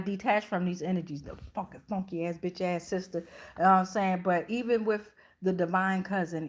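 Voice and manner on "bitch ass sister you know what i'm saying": normal talking voice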